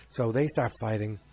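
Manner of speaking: normal talking voice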